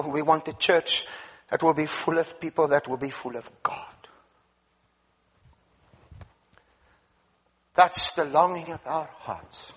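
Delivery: low, earnest pastor's prayer; closing prayer. Acted. Preacher leads closing prayer.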